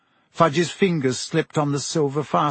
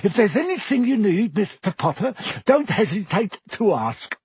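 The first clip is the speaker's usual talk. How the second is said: polite innkeeper voice; 6 semitones higher than usual. A narrator's reading. Tom voiced by narrator.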